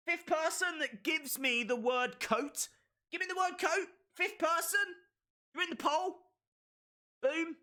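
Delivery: rally the chat at full pitch; hyped callout. Real, not acted. Streamer hyping chat to say coat, pitch soaring.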